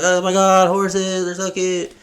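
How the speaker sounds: high cooing voice; mock gushing. Visitor spots horses in the field, mock gushing.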